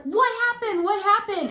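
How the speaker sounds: high urgent question; alarmed. Retold or imitated. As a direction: ask fast and alarmed. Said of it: "I was like".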